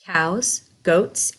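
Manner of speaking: usual speaking voice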